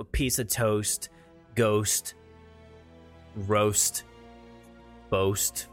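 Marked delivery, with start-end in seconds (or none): none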